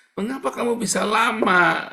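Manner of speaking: loud rebuke. Acted. Preacher scolding the congregation, loud challenge. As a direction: fire the question at them.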